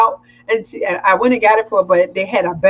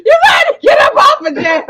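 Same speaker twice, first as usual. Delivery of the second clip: playful yell; 4 dB louder than usual. Acted. Teasing friend playfully.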